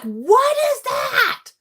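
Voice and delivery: high, slow, incredulous shout; appalled son. Retold or imitated. Son seeing mom dance, appalled high shout. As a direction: yell it like a mortified teenager. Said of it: "he's like".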